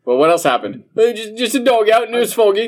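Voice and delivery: fast, high, loud British storyteller parody; mock British accent. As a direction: gabble it as a clueless Brit. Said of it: "British people don't read horror".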